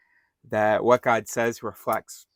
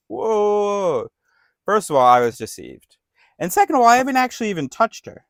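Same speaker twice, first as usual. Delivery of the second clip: alarmed protest; 10 semitones higher, 5 dB louder than usual. Retold or imitated. King caught out, protests to God.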